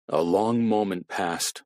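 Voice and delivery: plain reading voice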